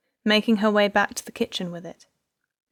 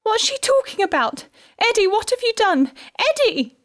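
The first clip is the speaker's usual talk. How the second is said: high, loud, frantic screaming; hysterical scream; 6 dB louder than usual. A narrator's reading. Wife screaming at her husband in hysterics. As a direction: shriek it in hysterics. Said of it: "she screamed hysterically".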